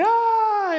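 high squealing scream; high scared shriek, playful; mock child scream. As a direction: squeal like a startled little girl. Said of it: "she's like".

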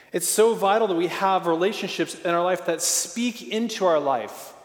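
normal talking voice

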